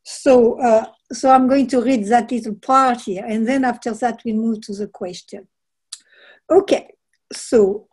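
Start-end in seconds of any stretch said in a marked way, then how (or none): none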